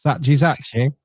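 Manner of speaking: put-on Grimsby pronunciation; playful copy of a Grimsby accent. Shown as a demonstration. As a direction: echo the Grimsby accent back. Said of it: "Br-, Grimsby accent".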